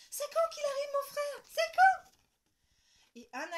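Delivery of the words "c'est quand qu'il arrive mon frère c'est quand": put-on very high, loud nagging child; child pestering at full volume; whining impatience. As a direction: nag louder and louder. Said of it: "répète Lola toute la journée".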